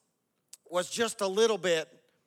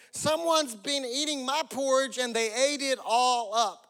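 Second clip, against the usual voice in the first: high storybook little-bear wail; crying baby bear; 6 semitones higher than usual. A narrator's reading. Baby bear finds porridge gone, mock crying out. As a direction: pitch up and complain, mock upset. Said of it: "cried the baby bear".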